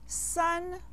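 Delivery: San held high and level; first tone. Shown as a demonstration. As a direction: keep San even on a high pitch. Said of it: "with the first tone".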